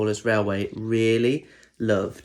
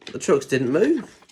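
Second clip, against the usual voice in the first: ordinary delivery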